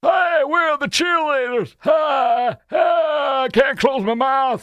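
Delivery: put-on high, slow, drawn-out old politician voice; a hammed-up Southern ex-president shout; Bill Clinton impression. Mimics Clinton, mouth hanging open.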